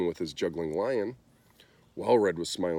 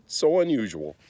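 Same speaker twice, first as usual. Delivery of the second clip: delighted; 4 semitones higher, 6 dB louder than usual. A narrator's reading. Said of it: "he cried".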